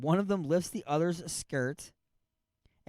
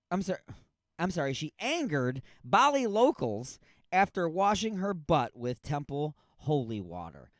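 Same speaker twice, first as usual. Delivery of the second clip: ordinary talk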